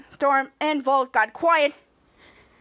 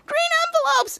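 much higher, excited cry; excited exclamation; 13 semitones higher than usual. Retold or imitated. Shadow joining the excited team, high exclamation. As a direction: pipe it up high in mock excitement.

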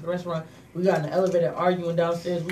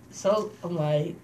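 ordinary talk

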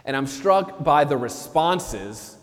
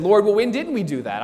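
raised, defensive protest; indignant goats; 6 semitones higher than usual. Acted. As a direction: push back at the accusation. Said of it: "they said".

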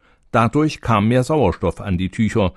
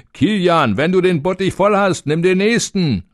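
higher, jeering call across the yard; 9 semitones higher than usual. A narrator's reading. Journeymen jeering at Kilian from outside, calling out. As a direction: tease him from outside the tavern. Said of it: "riefen sie ihm zu".

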